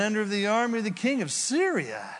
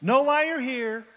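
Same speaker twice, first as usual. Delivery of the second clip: prophet's message; 7 semitones higher, 5 dB louder than usual. Acted.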